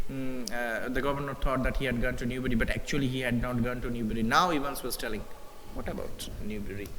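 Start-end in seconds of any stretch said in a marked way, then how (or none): 5.7-6.9 softer and lower, a sly hint; hushed sly suggestion. A narrator's reading. Evans slyly hinting at Newbury to the driver, hushed. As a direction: let it drop low and hushed. Teacher rereads Evans's line.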